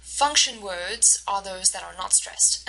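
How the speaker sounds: normal talking voice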